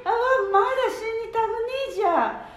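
frantic shouts at the driver; terrified backseat driver screaming; panicked yelling. Acted. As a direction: yell in alarm as the car runs the light. Grandma panics at grandpa's driving.

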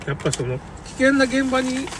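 normal talking voice

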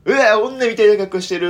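mocking schoolboy taunt; teasing classmate boys. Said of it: "っていうのを".

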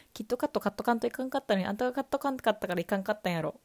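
Nagoya dialect tongue twister. Shown as a demonstration. A fan quoting the singer's tongue twister, fast in Nagoya dialect. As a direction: rattle it off quickly in Nagoya dialect. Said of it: "[name]ちゃんが言ってた早口言葉".

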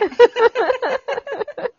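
loud high burst of laughter. Real, not acted. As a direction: crack up laughing. Both laughing. (大爆笑)

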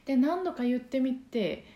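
ordinary talk, nothing marked

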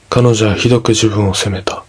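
ordinary delivery, nothing marked

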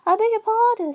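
high and meek apology; humble. A narrator's reading. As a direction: plead humbly, eager to please. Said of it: "said Alice very humbly".

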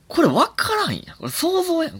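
high, worked-up complaint; getting carried away arguing it's just guesswork; indignant, half-laughing rant; excited protest. Real, not acted. Ranting about elephant science claims.

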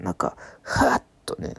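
a low, airy murmur on ふわっとね; dreamy low murmur. Retold or imitated.

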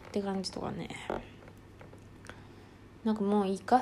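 normal talking voice

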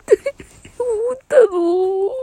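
real tearful. Real, not acted. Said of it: "リアルで泣いてます".

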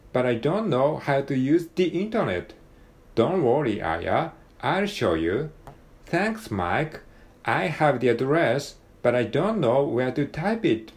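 connected natural English, lively rise and fall; model-CD English accent. Shown as a demonstration. Learner rereading the dialogue to recreate the model-CD English accent. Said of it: "お手本のシーディーっぽく".

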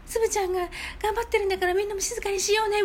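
put-on high, sweet girlish voice; gently rally the class like a kind schoolgirl; kind girl's voice. Kind girl urging classmates to hush, high and sweet. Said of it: "優しい女の子がいるでしょ？".

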